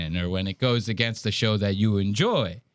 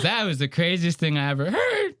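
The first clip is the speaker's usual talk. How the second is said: higher, squeaking up on the last word; mock voice crack; 4 semitones higher than usual. Host mocks a guy's squeak, mock voice crack. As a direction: imitate a pubescent voice breaking at the end. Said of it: "Did you hear that squeak?".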